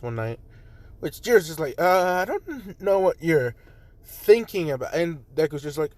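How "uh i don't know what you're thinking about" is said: flustered hesitation. Acted. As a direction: stretch the words out, flustered. Flustered at invitation to his place.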